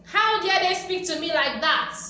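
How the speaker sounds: loud, high mock outrage, first words dragged slow; indignant outrage. Stung viewer fumes at harsh critics, mock outrage. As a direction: play the offended one, half-hearted fury. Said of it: "We get so pissed".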